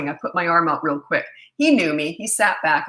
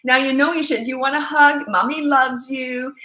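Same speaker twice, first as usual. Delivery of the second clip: gushing mom voice; 5 semitones higher, 3 dB louder than usual. Retold or imitated. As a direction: mimic an over-soothing mother. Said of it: "She's talking to him the whole time".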